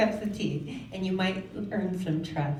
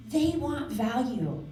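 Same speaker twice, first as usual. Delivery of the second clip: ordinary delivery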